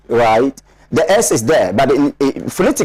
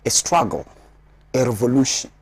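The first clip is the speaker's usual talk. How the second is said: ordinary delivery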